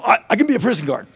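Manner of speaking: quick, high-pitched eager volunteering; eager reply. Retold or imitated. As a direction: jump at the job, fast and high. Acting out his eager reply.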